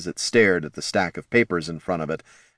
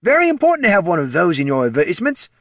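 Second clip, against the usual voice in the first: high, loud, fast put-on voice; high-pitched, quick know-it-all; helpful undead participant; 11 semitones higher, 5 dB louder, faster than usual. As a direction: rattle off advice in a high voice. Ted's voice in story.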